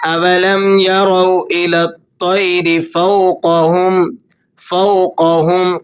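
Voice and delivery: measured, melodic tajweed recitation; Quran recitation. Acted. (تجوید کے ساتھ تلاوت)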